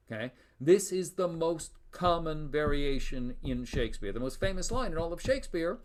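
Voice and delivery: normal talking voice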